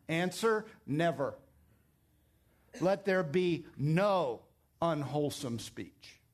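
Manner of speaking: usual speaking voice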